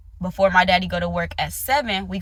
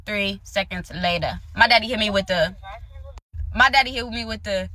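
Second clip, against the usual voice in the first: ordinary delivery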